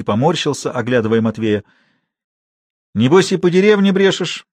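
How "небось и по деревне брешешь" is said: raised, pressing accusation; reproving challenge; stern questioning. A narrator's reading. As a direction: interrogate strictly, pitched up. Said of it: "строго спросил он".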